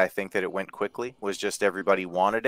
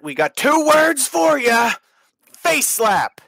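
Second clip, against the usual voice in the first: high, slow hammed-up catchphrase; wrestling promo voice; 7 dB louder, slower than usual. Catchphrase in put-on voice.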